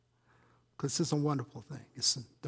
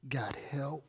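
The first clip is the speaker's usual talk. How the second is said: breathy whispered question; 3 semitones lower, 8 dB quieter than usual. Real, not acted. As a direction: drop to a whisper for the last word.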